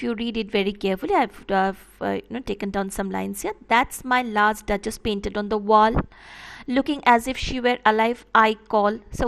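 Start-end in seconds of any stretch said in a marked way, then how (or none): 3.7-9.0 poem reading. Shown as a demonstration. Teacher reads poem lines.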